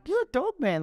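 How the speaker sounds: imitating telling off a nasty reviewer; mock scolding. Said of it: "I'm like".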